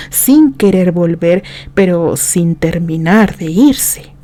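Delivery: usual speaking voice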